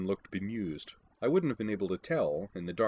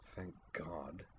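under-the-breath aside, much softer; muttered; 13 dB quieter than usual. A narrator's reading. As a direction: mumble it to yourself. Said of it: "Sakura muttered".

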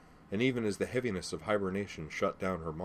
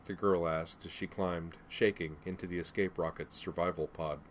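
ordinary delivery, nothing marked

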